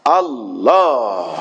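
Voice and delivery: Allah stretched very slow, final syllable pressed; slow prayer-leader call of Allah; drawn-out takbir call. Shown as a demonstration. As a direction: call Allah out long and slow, leaning on the end. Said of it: "শেষ শব্দটাকে আমি একটু জোর দেব".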